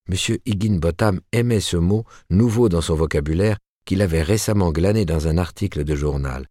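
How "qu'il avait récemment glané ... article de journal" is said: plain reading voice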